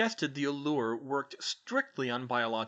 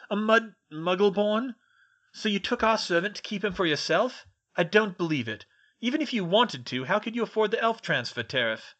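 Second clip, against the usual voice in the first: high, loud indignant interrogation; demanding; 8 semitones higher, 6 dB louder than usual. A narrator's reading. Malfoy to Hermione about his servant, loud indignant demand. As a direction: challenge her in outraged disbelief. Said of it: "Mister Malfoy demanded".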